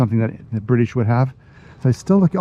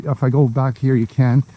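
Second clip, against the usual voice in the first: normal talking voice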